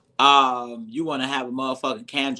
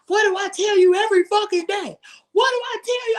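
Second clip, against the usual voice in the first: high, loud scolding shout; yelling the same reminder at her family daily; 6 dB louder than usual. Retold or imitated. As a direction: yell it at the family again and again. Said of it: "I yell".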